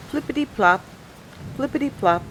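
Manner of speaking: lilting high plops; high playful sound words. A narrator's reading. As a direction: bounce it up high like raindrops.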